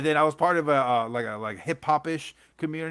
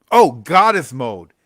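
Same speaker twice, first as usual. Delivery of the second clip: drawn-out, louder groan at the title; slow: "Oh, Goddess Mode" as if it hurts to say; loud groan of recognition; 13 dB louder, slower than usual. Real, not acted. Remembering a book he hated, slow loud groan.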